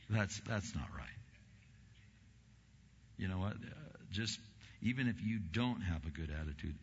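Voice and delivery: normal talking voice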